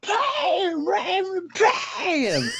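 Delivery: very high imitated screech; hammed-up falsetto scream; screaming woman. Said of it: "she just starts screaming".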